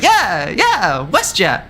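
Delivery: put-on girlfriend's eager reply. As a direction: play the keen tired girlfriend answering. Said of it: "And Kayla's like".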